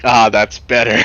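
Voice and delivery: loud, relieved mock contentment; wife's insult makes him relax; satisfied sigh. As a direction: sigh it out with pleasure. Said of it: "And I'm like".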